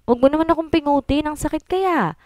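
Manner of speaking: high, quick playful whine; husband whining to his wife, high and fast; whiny husband. Acted. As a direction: beg her to stop pinching, half-laughing.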